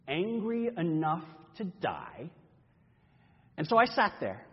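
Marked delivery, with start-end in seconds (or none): 0.1-2.3 slow bitter anger. Acted. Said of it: "you're really angry about this".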